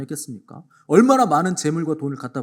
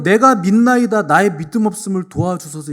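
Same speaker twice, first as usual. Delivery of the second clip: higher, much louder anguished cry; desperate cry; 6 semitones higher, 10 dB louder than usual. Retold or imitated. Preacher voicing the father's cry, anguished and loud. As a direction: wail it out to the congregation. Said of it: "그 절규하도 외쳤어요".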